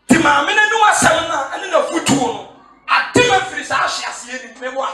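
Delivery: fiery sermon shout; preaching. Acted. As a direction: drive the point home to the church. Preacher rising to full force.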